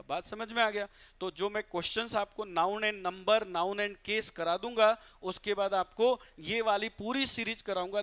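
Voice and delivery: ordinary talk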